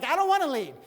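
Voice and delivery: high, loud, whining refusal; whiny Moses. Retold or imitated. Moses whining to God that he won't lead, high and loud. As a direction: protest like a reluctant kid. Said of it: "Moses was like".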